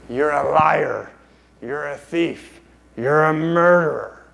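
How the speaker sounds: slow, drawn-out accusing creature voice; Gollum. Acted. Gollum accusing Smeagol, slow put-on creature voice. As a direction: stretch out each accusation. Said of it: "the golem character says".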